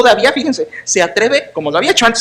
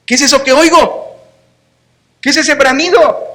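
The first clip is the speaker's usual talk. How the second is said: sarcastic challenge; 5 semitones higher than usual. Retold or imitated. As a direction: throw the question back in his face. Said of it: "Notas el sarcasmo".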